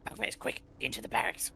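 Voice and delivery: quick breathy whisper; urgent whisper. Acted. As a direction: hiss it quickly to the bugbears. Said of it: "whisper, but, like, just say".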